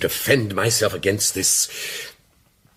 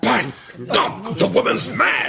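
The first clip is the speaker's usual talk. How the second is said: much higher, sudden indignant cry; outraged outburst; 13 semitones higher, slower than usual. Acted. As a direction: burst out in shocked outrage at the accusation. Accused man explodes in outrage.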